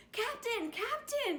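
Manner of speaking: high, loud calls to the captain; a frog shouting for his friend at the cabin door; calling out. Acted. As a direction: call up the ladder, urgent and bright. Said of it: "he called".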